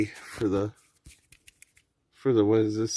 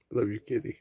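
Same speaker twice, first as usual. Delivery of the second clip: softly, lovingly to a pet; tender to the kitty; 5 dB quieter, much faster than usual. Real, not acted. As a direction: coo it gently to the cat. Soft talk to cat.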